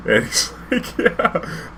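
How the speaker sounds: breathy laugh into a high squeaked yeah. Real, not acted. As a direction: laugh through the quote. Laughing while quoting client.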